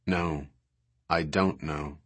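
low, soft and flat. A narrator's reading. Sarah answers the girl's excuse about math in a flat voice. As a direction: answer flatly, voice dropped and quiet. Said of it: "Sarah's voice was flat".

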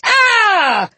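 high, loud startled scream, falling away; answering scream. A narrator's reading. Startled figure screaming back at the children, scream. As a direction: scream back, just as taken aback. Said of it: "the figure screamed in return".

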